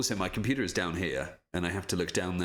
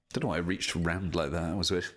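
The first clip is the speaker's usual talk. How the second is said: ordinary talk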